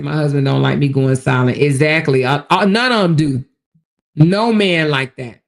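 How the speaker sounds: emphatic loud agreement. Real, not acted. Reading comment, loud emphasis.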